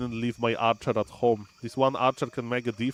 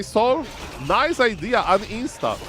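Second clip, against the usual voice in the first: mocking chat voice; 7 semitones higher, slower than usual. Chat heckling the streamer to quit, mock jeer. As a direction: tease the streamer as a heckler would. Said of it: "And you may say".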